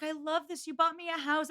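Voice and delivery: high-pitched delighted quote; acted gushing over a gift. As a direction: lightly imitate a thrilled wife. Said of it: "not in the moment".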